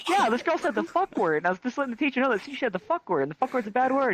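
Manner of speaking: high-pitched tattling schoolkid; faux-innocent high voice, telling on someone; mock innocence. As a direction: explain it in a high, put-on innocent voice. Pupil explains innocently to AP.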